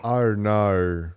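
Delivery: drawn-out Australian "no" with an R; mock Australian accent. Shown as a demonstration. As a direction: stretch "no" in put-on Australian. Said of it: "Why do Australians put R's in their words".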